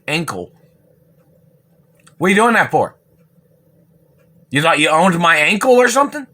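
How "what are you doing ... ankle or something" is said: higher, louder accusing shout; angry demand. Real, not acted. Accusing the satellite man of burning his ankle, angry and loud. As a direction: confront them, loud and indignant.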